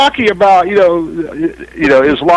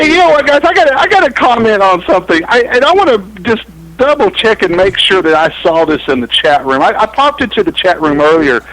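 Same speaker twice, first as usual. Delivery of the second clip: ordinary delivery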